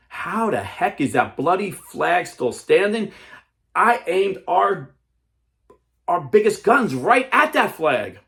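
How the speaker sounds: high, loud, slow furious captain; angry enemy captain. Acted. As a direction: rage at the flag, slow and loud. Enemy captain voiced in story.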